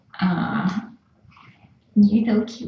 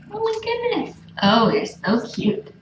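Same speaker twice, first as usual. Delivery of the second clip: cooing; 10 semitones higher than usual. Real, not acted. Cooing over kittens.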